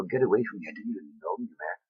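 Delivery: soft, hushed dismissal, fading away; God's rebuke. Retold or imitated. God turning away the false followers, softly. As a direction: let the rebuke drop to a hush at the end. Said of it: "You go".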